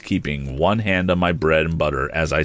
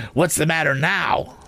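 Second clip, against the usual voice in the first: high, pert questioning from a scolding sister; smart, sharp demand; 10 semitones higher than usual. A narrator's reading. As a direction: snap it out smartly, pitched high. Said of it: "she said smartly".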